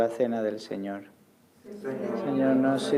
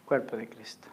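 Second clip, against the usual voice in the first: hushed whisper; 3 semitones lower, 9 dB quieter than usual. Real, not acted. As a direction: whisper it reverently. Giving communion.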